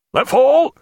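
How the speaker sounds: high, loud sharp order; cried command. A narrator's reading. Captain to sailors aloft before the attack, cried out. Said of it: "he cried".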